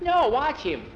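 high, loud urging; urgent insistence. Acted. Boy insists friend watch the wiggling ears, urgent insistence. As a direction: make your friend look right now.

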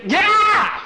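high, loud startled yelp; frightened shout; startled yell. Acted. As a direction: jump out of your skin. Jackie sneaks up, scares him.

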